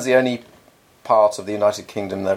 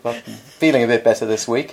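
normal talking voice

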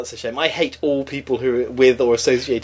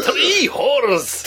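put-on Pakistani accent; 10 semitones higher than usual. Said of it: "Pakistani accent?".

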